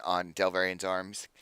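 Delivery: ordinary talk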